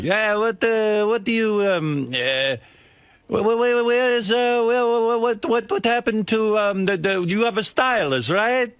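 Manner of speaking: put-on high, excited broken English in a thick Italian accent. Italian watch salesman quizzing a customer about his stylist, thick Italian accent. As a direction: imitate a fresh-off-the-boat Italian salesman, hammed up and high. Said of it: "he had some foreign accent. I think he was Italian".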